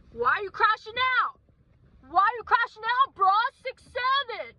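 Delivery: very high, loud mocking squeal; deliberately annoying, over-the-top silly voice; childish taunting. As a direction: taunt a kid in an absurd falsetto. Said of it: "annoy him back".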